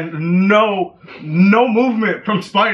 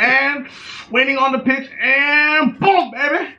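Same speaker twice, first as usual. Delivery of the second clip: higher cowboy voice building to a yell; hammed-up cowboy calling the swing, higher; Cowboy Jeff voice; 8 semitones higher, slower than usual. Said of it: "my best Cowboy Jeff impression".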